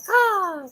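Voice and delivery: high mock crow caw; sharp crow call. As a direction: squawk it like a crow.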